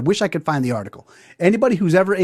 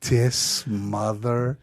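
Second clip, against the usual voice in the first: muttered disbelief; 5 semitones lower, 6 dB quieter, much slower than usual. Retold or imitated. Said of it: "And I'm like".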